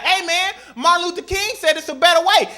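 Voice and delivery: high-pitched upbeat appeal; positivity. Acted. Bank customer to robber, trying positivity in a high voice. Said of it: "tried to hit him with the positivity".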